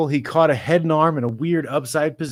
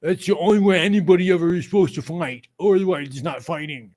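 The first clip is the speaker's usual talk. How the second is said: a hint of a whiny mocking voice; mocking commenter; 4 semitones higher than usual. Parodying a stand-up-only fan, lightly mocking. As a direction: sneer like a know-it-all fan. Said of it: "Not this".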